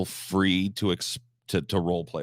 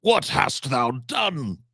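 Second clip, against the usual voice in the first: put-on high, slow, theatrical old-stage delivery with far higher pitch; mock Shakespearean actor; 7 semitones higher, much slower than usual. Shown as a demonstration. Streamer mocking stuffy Shakespeare delivery to chat, hammed-up theatrical. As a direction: ham up an old-fashioned Shakespeare actor, lofty and drawn out. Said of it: "You don't have to sound like this".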